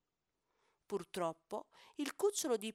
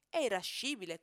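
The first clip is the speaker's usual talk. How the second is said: ordinary delivery, nothing marked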